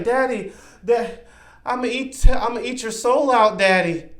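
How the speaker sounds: high, innocent daughter imitation; creepy threat in a sweet girl's voice. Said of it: "in such an innocent attitude".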